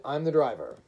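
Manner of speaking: loud, commanding introduction; brusque announcement. A narrator's reading. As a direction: announce yourself with blunt authority. Driver announces himself.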